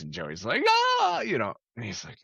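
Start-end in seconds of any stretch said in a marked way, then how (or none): 0.7-1.2 high yelp. Retold or imitated. Joey reacting.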